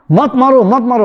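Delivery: high, repeated plea without real shouting; mock terrified cry. Wife running down the road at night, mock scream. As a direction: imitate someone fleeing in panic. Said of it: "चिल्ला के वहां से सीधे".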